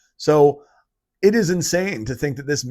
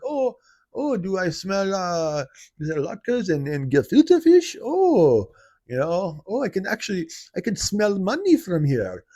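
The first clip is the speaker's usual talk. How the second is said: much higher, cartoonish Yiddish accent; 6 semitones higher than usual. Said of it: "Hebrew accent or whatever accent".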